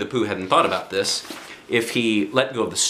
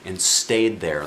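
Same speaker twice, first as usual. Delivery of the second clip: ordinary delivery, nothing marked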